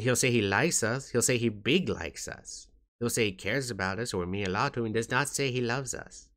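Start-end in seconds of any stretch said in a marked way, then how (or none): none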